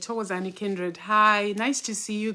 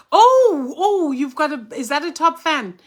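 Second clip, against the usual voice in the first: surprised; 7 semitones higher, 9 dB louder than usual. Real, not acted. Spots a viewer's top fan badge.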